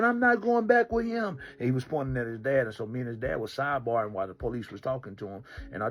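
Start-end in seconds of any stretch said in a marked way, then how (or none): 0.0-1.4 put-on high, slow child's refusal; a slow, high, stubborn child's voice; defiant boy. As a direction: dig in like a stubborn kid. Boy refusing, pointing at dad.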